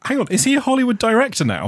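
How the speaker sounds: high incredulous question; sudden disbelieving squeak; mock surprise. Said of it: "everyone was like".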